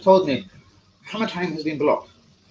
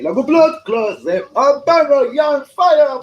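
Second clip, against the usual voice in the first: high, loud mock Jamaican rant; hammed-up Jamaican outburst; angry Jamaican impression; 12 semitones higher, 8 dB louder, slower than usual. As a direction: mimic him ranting in Jamaican patois. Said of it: "he puts on the Jamaican thing".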